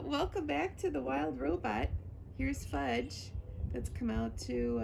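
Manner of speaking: plain reading voice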